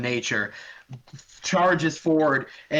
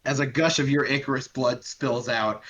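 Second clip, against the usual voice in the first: ordinary delivery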